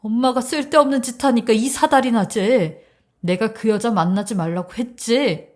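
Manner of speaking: heated, insistent reproach; exasperated berating. Acted. Son blames his mother for wrecking his future, exasperated. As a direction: plead and scold at once, fed up.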